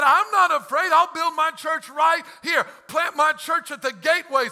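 high, loud fearless shout; shouting defiance at the gates; defiant shout. Retold or imitated. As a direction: declare it without fear. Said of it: "Jesus said".

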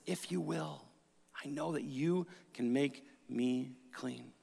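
hushed pleading. Acted. Leper begging Jesus.

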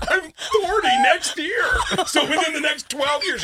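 loud, high, laughing half-sob; high, loud laughing wail; laugh-crying. Real, not acted. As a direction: laugh through mock despair. Laughing distress at bad news.

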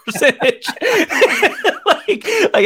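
breaking into high laughter mid-word; laughing. Real, not acted. Laughing at stat.

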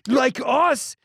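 put-on high, animated Persian accent; excited fan with a thick Persian accent. Host voices delighted Persian fans, high Persian accent. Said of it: "my Persian fans are going".

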